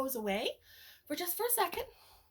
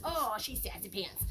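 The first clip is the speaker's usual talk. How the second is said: ordinary delivery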